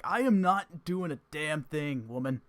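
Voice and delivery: loud, slow mock indignation; Gene protesting. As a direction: drag out the denial. GM voices Gene.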